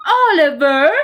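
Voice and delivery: loud high call after a cat. A narrator's reading. Mister Sims calling his fleeing cat, loud shout. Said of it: "Mister Sims called out".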